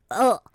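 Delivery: loud imitated groan of disgust; imitated disgusted ugh. Host mimicking all the listeners' disgusted groan. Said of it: "All of the people listening just went".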